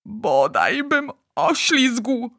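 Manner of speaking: high, very slow exclamation; despairing cry. A narrator's reading. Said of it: "zakrzyknął".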